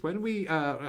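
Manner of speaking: usual speaking voice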